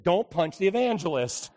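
loud, emphatic punchline; mock rebuke. Pastor throwing the sling back at Hazel, loud mock rebuke. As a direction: bark it as a playful telling-off.

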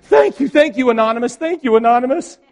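high, loud, overcome with thanks; tearful thanks. Retold or imitated. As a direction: gush thanks through tears, imitating the lady. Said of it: "The lady's crying".

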